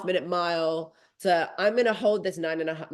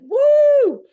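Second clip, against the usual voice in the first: finish-line whoop; 12 dB louder than usual. Retold or imitated.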